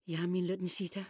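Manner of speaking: soft, low, soothing; tender reassurance. Acted. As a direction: hold him close and calm him. Mother comforts him softly.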